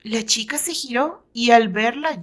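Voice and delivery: ordinary delivery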